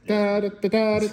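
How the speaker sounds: high, loud da-da Morse beeps; Morse code beeping. Pretend. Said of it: "it was Morse code for".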